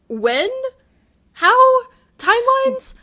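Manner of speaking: a real shocked "what?!" at the twist; incredulous outburst. Real, not acted. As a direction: squeak it out in total disbelief. Baffled by the plot twist.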